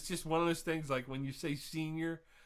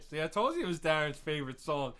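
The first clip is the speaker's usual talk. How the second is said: ordinary talk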